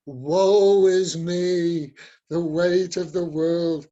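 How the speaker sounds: very slow, drawn-out mock wail; hammed-up woe-is-me voice; victim pity voice. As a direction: groan it out like a self-pitying victim. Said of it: "my victim pity voice".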